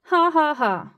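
loud, high voiced guffaw; uncontained laughter. A narrator's reading. Onlooker unable to contain his mirth, laughing. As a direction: burst out in a loud ha-ha-ha. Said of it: "Wrath couldn't contain his laughter".

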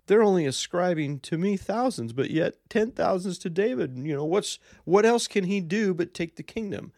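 Saul losing it, voice shooting up high; freaked-out Saul. Retold or imitated. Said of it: "he freaked out and said".

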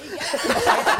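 high hooting laugh; loud burst of laughter. Real, not acted.

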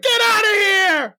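high, drawn-out panicked yell; embarrassed flip-out. Acted. Kid yelling at mom knocking on the door, embarrassed shriek. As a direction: shriek it like a mortified teenager. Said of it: "flipped out 'cause you're embarrassed".

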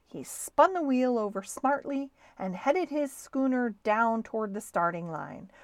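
usual speaking voice

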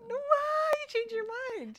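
high, loud burst of disbelief; surprised protest. Real, not acted. Co-host hears the haircut is off, loud surprised protest.

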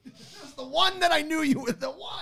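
playful fury at losing the pick; mock outrage. Real, not acted. Friend steals his planned pick.

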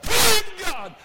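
sudden high joyful yell; whooping praise shout. Retold or imitated. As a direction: cry out like a happy church sister. Sister gets happy in church.

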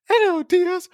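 mock Mrs. Doubtfire. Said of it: "trying to do a bad British accent".

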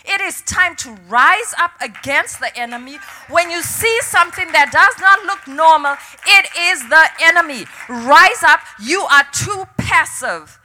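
loud, rising call to rise up; rally them like a preacher at full force; emphatic quoted divine command; the Lord's call. Retold or imitated. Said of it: "He said".

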